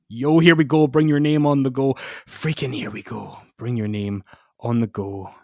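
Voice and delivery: swinging between loud declaiming and near whisper; hammed-up dramatic reading of wrestling lyrics. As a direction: read the lyrics like a dramatic poem. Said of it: "do a dramatic reading of the lyrics".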